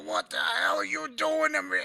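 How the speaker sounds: plain reading voice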